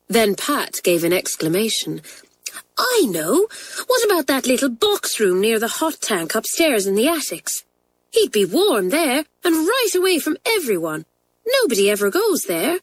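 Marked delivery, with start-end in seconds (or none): 2.8-12.8 put-on high girl's voice bursting with an idea; excited idea. A narrator's reading. Pat proposes the attic box room to friends, excited. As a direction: share the plan eagerly.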